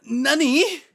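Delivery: high, loud mock astonishment; hammed-up Japanese-catchphrase surprise; anime shock. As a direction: exclaim it like a startled anime hero. Acting the anime hero's shock.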